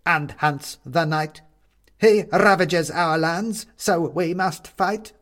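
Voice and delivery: the goblin king warns the captive, slow and booming; ominous goblin king. Acted. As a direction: proclaim the threat like a grim monarch.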